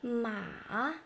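"Ma" low, dipping then rising; long falling-rising "Ma"; third tone. Shown as a demonstration. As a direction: sink "Ma" deep, then bring it up. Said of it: "Third tone".

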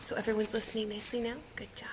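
quick, very soft whisper. Shown as a demonstration. Leader checking everyone's listening nicely now, whispering. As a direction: keep it hushed. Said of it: "Just a whisper voice".

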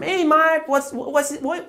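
high-pitched mock English chatter; sudden English speaker. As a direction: burst into high, imitation fluent English. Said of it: "sabah bir kalkacağım".

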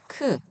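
크 short and high with a puff; aspirated 크. Shown as a demonstration. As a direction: release the k of 크 with air. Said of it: "이렇게 읽으시면 되시고요". (거센소리로 짧게 크)

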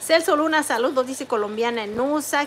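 ordinary talk, nothing marked

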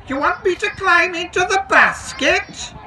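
very high, squeaky worried dragon voice; anxious dragon. A narrator's reading. Dragon asks the station master about the basket, anxious high squeak. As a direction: ask anxiously in a tiny high creature voice. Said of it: "asked the dragon anxiously".

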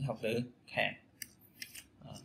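ordinary delivery

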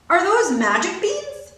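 high, slow wondering question; a high wondering mother; hopeful question. A narrator's reading. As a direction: ask with hushed hope. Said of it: "asked Missus Cream".

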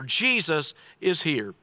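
usual speaking voice

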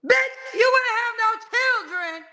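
high, slow, indignant yell at the cousin's wife; retold outburst. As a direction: let the outrage rip out, drawn out. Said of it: "I was so mad".

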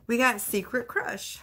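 ordinary delivery

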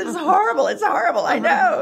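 loud and high through laughter; laughing along. Retold or imitated. Colleague laughing about the deaf customer, loud laughing agreement. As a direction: crack up while agreeing. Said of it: "he says".

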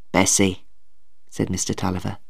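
low, subdued voice; moved old father. A narrator's reading. Father turns to his wife, quietly moved. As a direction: speak low to your wife.

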